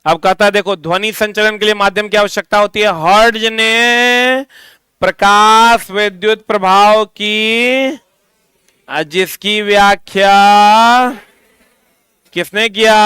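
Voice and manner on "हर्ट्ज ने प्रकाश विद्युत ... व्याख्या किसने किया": slow phrases with drawn-out endings; dictation. Shown as a demonstration. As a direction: dictate phrase by phrase, holding the last word. (रुक-रुक कर, खींचकर लिखवाते हुए)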